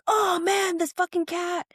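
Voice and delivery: hammed-up fed-up groan at the cat; exasperated curse. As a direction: throw up your hands, high and fed up. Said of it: "every single time he's like".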